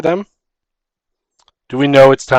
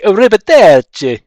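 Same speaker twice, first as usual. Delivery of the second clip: a hammed-up Italian goodbye, pitched higher; Italian gnome accent; 6 semitones higher, 4 dB louder than usual. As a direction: toss off an exaggerated Italian farewell. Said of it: "they were Italian".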